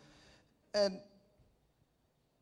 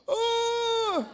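long high wail of panic; mock scared scream; 7 dB louder than usual. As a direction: shriek like a frightened kid. Boy's scream seeing the driver.

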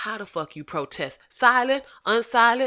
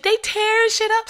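high, loud mock complaint; mock whining; 14 semitones higher, 6 dB louder than usual. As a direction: imitate upset complainers, voice pitched way up. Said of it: "crying and shit".

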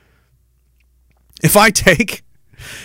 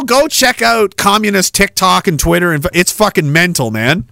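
ordinary delivery

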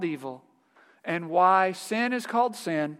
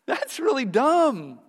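slow, higher mock-incredulous scoff; dismissive scoff; 8 semitones higher, 4 dB louder, slower than usual. All creation judging false accusers, slow mocking scoff. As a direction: drag out the disbelief, mocking. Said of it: "all creation is gonna go".

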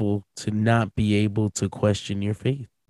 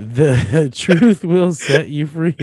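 slow, louder, laughing through it; laughing mock solemn; 5 semitones higher, 8 dB louder, much slower than usual. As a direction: drag out the phrase like a grand motto, giggling. Repeats the line as grand mockery.

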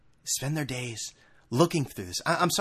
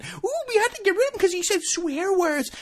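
much higher, mincing impression of an offended critic; prissy mock voice. Mocking the critics of a Rutgers coach, much higher and prissy. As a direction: squeal it like a scandalised prude.

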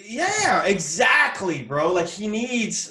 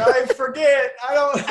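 panicked dimwit; 7 semitones higher, 7 dB louder than usual. Pretend. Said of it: "You're like".

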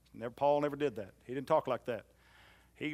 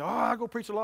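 put-on young man's boast; 9 semitones higher, 5 dB louder, faster than usual. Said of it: "young men who say".

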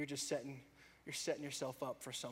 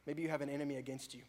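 normal talking voice

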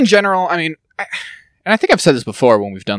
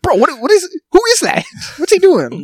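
much higher, baffled with a laugh; incredulous. Retold or imitated. Asking the friend who that man is, high and disbelieving. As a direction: ask in disbelief.

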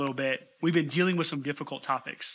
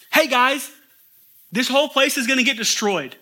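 higher, louder urgent call; urgent warning; 7 semitones higher, 5 dB louder than usual. Retold or imitated. Lot warning the fiancés of coming destruction, urgent. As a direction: sound the alarm to the guys. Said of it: "he did that with urgency".

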